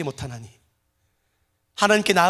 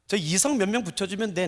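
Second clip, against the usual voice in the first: ordinary talk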